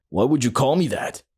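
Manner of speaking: understated offended question; mildly mad. Retold or imitated. Offended guy confronting the teller, mild retold anger. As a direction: lightly imitate an annoyed man demanding why. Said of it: "The guy got mad".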